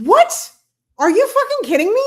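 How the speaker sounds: loud, high, incredulous outburst; real shocked disbelief. Real, not acted. Podcaster learns of a surprise brother, loud shocked disbelief.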